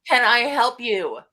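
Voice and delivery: mocking the dreaded sales opener, slow and loud; slow salesy line. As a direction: stretch it like a pushy rep. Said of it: "the famous line you shouldn't use".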